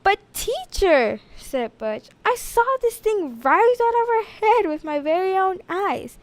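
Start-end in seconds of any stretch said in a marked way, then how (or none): 0.0-1.2 put-on a higher, slow pleading boy; protesting boy. Butch protests. 2.3-6.2 put-on insistent boy. Butch insists.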